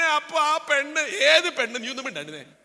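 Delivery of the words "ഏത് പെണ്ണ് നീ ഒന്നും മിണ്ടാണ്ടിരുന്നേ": ordinary delivery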